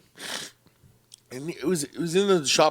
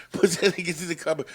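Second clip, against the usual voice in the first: ordinary talk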